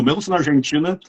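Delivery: ordinary talk, nothing marked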